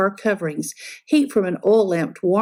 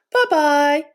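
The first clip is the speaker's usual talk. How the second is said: middle-sized voice; 6 semitones higher, 3 dB louder than usual. A narrator's reading. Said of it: "a middle-sized voice".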